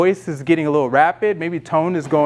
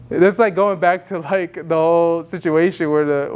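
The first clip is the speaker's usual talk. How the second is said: ordinary talk, nothing marked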